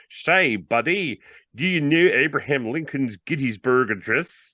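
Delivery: hammed-up American buddy; appalling American accent. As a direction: put on a terrible American accent. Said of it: "he put on an appalling American accent".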